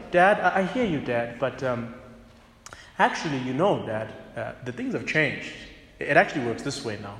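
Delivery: soft, gentle and polite to dad; calm, honoring answer; respectful reply. Acted. Son answering his father softly and respectfully. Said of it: "rather say".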